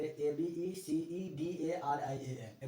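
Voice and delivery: slow letter-by-letter spelling of A B E C E D A R I A N; dictating the spelling of abecedarian. Shown as a demonstration. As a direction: say each letter of A B E C E D A R I A N apart, slowly. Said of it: "जैसे".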